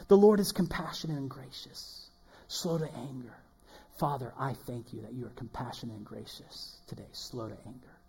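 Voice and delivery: soft, fading prayer voice; hushed prayer demo. Shown as a demonstration. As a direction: pray the verse quietly to God. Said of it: "Begin to pray it out".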